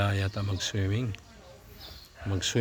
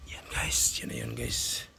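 breathy hiding whisper; whispering while hiding; 13 semitones lower, 4 dB quieter than usual. Real, not acted. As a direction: whisper so you aren't caught. Hiding while filming the demolition.

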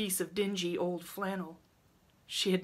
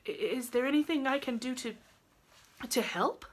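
put-on high, halting young woman's offer; hesitant; 7 semitones higher than usual. A narrator's reading. Rilla offers help to the old woman, hesitant. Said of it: "hesitated Rilla".

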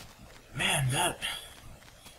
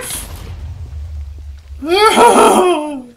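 laughing shout; 15 dB louder than usual. Real, not acted.

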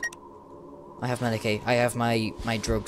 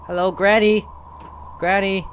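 calling out; 6 semitones higher, 4 dB louder than usual. Real, not acted. Calling out to game enemy.

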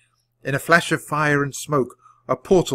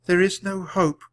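ordinary delivery, nothing marked

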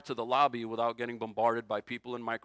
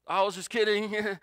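higher, louder, playfully sheepish; joking excuse; 6 semitones higher, 5 dB louder than usual. Pretend. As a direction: toss it off like a lame cover story. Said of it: "What's he supposed to say?".

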